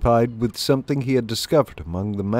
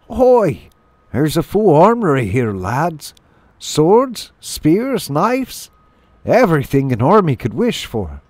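high, eager calling voice; excited hedgehog lad; 7 semitones higher than usual. Acted. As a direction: announce the find, pitched high. Voices hedgehog announcing armoury find.

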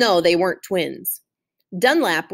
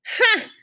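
a single very high, sharp ha; laugh; 13 semitones higher than usual. Acted. Gloria laughs at Opal's lunch meat nickname, one high ha. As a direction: bark out one delighted laugh. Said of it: "Gloria Dump laughed".

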